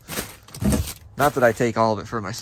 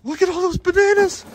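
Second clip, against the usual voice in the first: squealing much higher and louder; excited; 8 dB louder than usual. Real, not acted. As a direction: shriek it out in delight at the haul. Thrilled at finding bananas.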